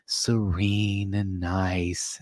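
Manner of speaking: hushed, very slow golf-broadcast voice; Jim Nance impression. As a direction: imitate a serene commentator, softly drawing out each word. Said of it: "he's just talking like this and it's so serene".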